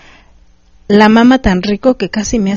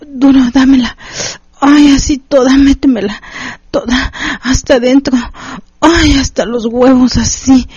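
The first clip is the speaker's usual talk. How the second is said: higher and louder, moaning demands; 4 semitones higher than usual. A narrator's reading. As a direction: cry out each word in rising pleasure. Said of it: "Gemía y me decía". (gemidos intensos, voz aguda y fuerte)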